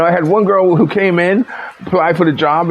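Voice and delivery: ordinary delivery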